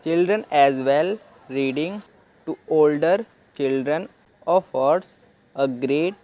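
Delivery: slow, word by word, with pauses; slow reading aloud. Shown as a demonstration. Teacher reading the English sentence slowly aloud. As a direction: read slowly so learners follow. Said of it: "तो great को हम थोड़ा deeply पढ़ लेते हैं".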